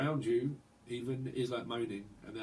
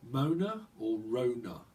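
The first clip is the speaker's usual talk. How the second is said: ordinary delivery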